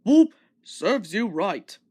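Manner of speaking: loud hoot, then slow and smug; honk, then gloat slowly; big engine teasing through the tunnel; Gordon's boop. A narrator's reading. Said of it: "Gordon would say".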